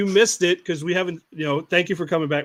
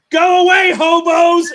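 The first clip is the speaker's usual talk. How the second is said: high, loud shouting voice; mock shout; 11 semitones higher, 6 dB louder, slower than usual. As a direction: yell it at the trick-or-treaters.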